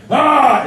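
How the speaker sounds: very loud, high "ah" scream; sudden high shout; shouted yell. Shown as a demonstration. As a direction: demonstrate an all-out throaty battle yell. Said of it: "and when I shouted".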